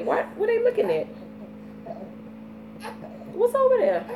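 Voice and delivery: put-on high, curious baby voice; voicing the babies. Mom voices her babies wondering what they see.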